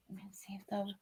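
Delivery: near-whisper, low and very soft; muttering to oneself. Real, not acted. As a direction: murmur under your breath while checking. Talking to self while checking cards.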